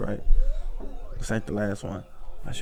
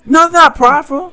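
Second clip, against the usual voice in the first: higher, louder protest; an offended, raised "no"; indignant denial; 12 semitones higher, 4 dB louder than usual. Real, not acted. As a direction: push back hard on the word. Rejecting the word prideful.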